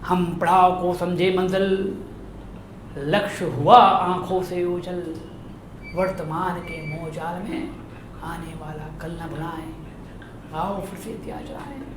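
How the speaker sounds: soft, measured poem recitation with long pauses; hushed, solemn verse reading; poem recital tribute. Acted. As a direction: recite it gently as a tribute, swelling midway, sinking almost to a hush. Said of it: "भावांजलि उनकी पंक्तियों से मैं देना चाहता हूं".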